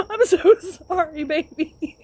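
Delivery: high, loud apology cracking with laughter; laughing apology. Retold or imitated. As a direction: say sorry through laughter. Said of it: "I was like".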